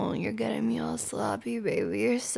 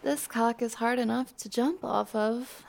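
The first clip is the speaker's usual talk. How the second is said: ordinary delivery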